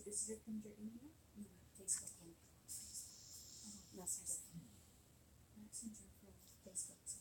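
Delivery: hushed whispered aside; whispering. Real, not acted. Helper whispering about the link during the broadcast. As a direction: whisper so the stream won't hear.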